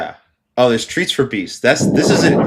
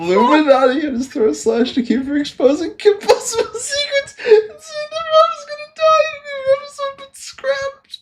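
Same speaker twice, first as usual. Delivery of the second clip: high, squealing voice breaking with laughter; cracking up. Real, not acted. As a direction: read it out while losing it completely. Cracking up reading comment.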